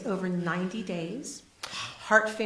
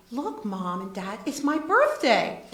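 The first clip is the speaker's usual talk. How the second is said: put-on a child's happy shout, rising louder at the end; excited little boy; 3 semitones higher, 7 dB louder than usual. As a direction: proudly announce your birthday like a seven-year-old. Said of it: "the little boy says".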